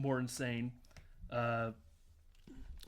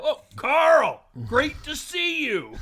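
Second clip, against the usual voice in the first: much higher, much louder, slow; startled fake cheer; 13 semitones higher, 13 dB louder, much slower than usual. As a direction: overdo a caught-out greeting. Caught kissing, fake cheerful greeting.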